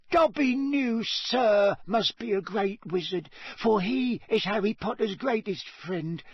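high, fervent house-elf squeak; Dobby. A narrator's reading. Dobby praises Ron as Harry's friend, high and fervent. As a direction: gush in a squeaky voice, stretching the praise.

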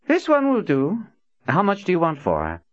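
lordly buyer, pitched well up; haughty Prophet. A narrator's reading. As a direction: look her over like goods and name your price. Prophet appraising slave, haughty.

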